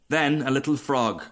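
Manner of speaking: plain reading voice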